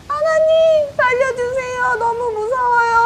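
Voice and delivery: high crying plea, acted; tearful praying. Disciples praying on a rocking boat, tearful high wailing. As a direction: wail a frightened prayer. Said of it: "하고 울면서 기도를".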